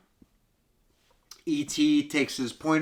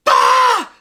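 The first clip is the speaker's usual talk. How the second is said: very high, loud mock scream; 14 dB louder than usual. Mom finding dying ET, very high loud mock scream. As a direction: shriek in fake horror. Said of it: "she's like".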